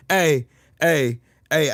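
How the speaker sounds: usual speaking voice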